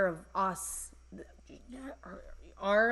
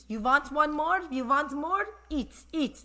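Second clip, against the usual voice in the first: higher and louder, pressing food on a guest; a mock Persian auntie pushing more food; pushy Persian host; 7 semitones higher, 5 dB louder than usual. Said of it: "but, like, Persian style".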